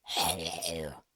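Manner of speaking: a throat screamed raw, croaking hello; croaky hello. Shown as a demonstration. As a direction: drag out a low hoarse hello. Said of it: "Or is it more like a".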